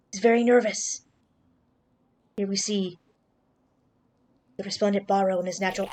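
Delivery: hushed nature documentary host; soft wildlife presenter voice. Pretend. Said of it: "I tried to Steve Irwin that".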